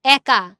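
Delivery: একা as "æka", loud and separate; open "ae" vowel. Shown as a demonstration. Teacher testing examples, একা with open "æ". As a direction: begin একা with a wide "æ". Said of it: "এর উচ্চারণ স্বাভাবিক না".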